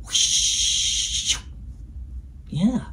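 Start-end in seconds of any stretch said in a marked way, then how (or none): 0.0-1.4 long, airy whoosh without voice; soft rush of air, drawn out; whoosh of a wing. Shown as a demonstration. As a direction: whoosh like a bird going through the air. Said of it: "try that noise with me".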